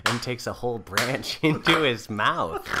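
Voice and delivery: low, even storyteller voice; story narration. A narrator's reading. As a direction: set the scene like a narrator. Narrator describes the scene.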